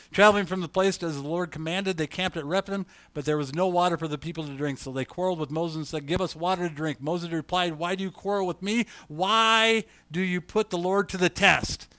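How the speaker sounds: preacher reading Exodus aloud; scripture reading. Acted. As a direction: read it out to the congregation.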